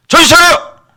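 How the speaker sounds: high, explosive scolding shout; stern rebuke. Shown as a demonstration. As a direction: shout them awake, loud and high. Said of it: "어미 꾸짖으라". (엄하게 호통치듯)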